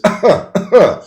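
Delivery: loud, high, voiced cough; normal cough. Shown as a demonstration. Instructor showing students a healthy cough, normal cough. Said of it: "And normal is".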